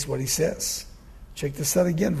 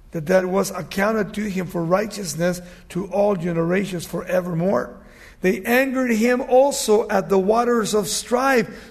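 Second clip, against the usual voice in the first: loud, raised scripture declamation; forceful preaching; 5 semitones higher, 9 dB louder than usual. Acted. Preacher reads God's judgment to the congregation, forceful preaching. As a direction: proclaim the judgment with full projection.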